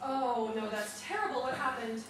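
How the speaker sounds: low, hushed concern; quiet condolence; soft sympathy. Acted. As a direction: murmur it sympathetically. Sympathy for the lame dog.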